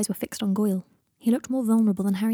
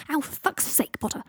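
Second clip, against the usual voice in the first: higher-pitched, exasperated acted ow; pained protest; 7 semitones higher than usual. A narrator's reading. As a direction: curse at being hurt, without shouting. Carried man yelps in pain.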